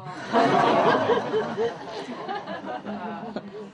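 a high airy peal of laughter; high breathy laugh. Real, not acted. Host laughing at the clerk joke. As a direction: laugh at the locked door punchline.

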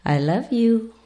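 high, squawky parrot saying I love you. Retold or imitated. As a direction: mimic the cockatoo's talking voice. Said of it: "looked at my eyes and said".

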